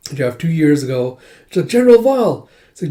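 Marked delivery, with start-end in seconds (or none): 1.7-2.5 high, loud fangirl call; mock swooning call to an idol; imitated adoring admirer.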